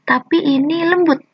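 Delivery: usual speaking voice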